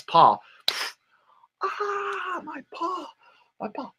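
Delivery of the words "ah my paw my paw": high, softer crying yelps of pain; wolf crying out. Acted. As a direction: wail as the hurt wolf. Wolf's paw stepped on.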